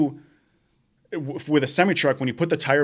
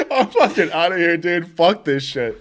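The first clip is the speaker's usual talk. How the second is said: high laughing fed-up voice; laughing exasperation; 4 semitones higher, 4 dB louder than usual. Retold or imitated. Worker quitting the messy job, laughing fed-up voice. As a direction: laugh it off and bail. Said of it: "I was like".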